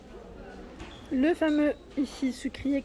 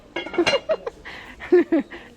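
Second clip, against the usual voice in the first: laugh; 6 semitones higher, 4 dB louder than usual. Real, not acted. Laughing while on tiptoe.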